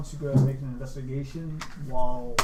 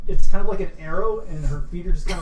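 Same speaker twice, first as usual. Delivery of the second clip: excited; 4 semitones higher, 10 dB louder than usual. Acted. Describing her flying like arrow.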